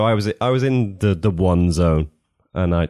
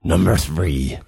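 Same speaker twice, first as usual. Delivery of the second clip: put-on old sailor; 3 semitones higher than usual. Pirate voice reading.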